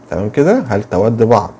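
usual speaking voice

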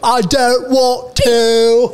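high, drawn-out British whine; mock British accent. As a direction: imitate a stubborn British rocker, slowly. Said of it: "two most obnoxious accents possible".

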